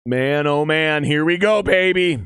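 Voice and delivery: real fan excitement; excited cheer. Real, not acted. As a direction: hype up the touchdown. Touchdown scored, cheering.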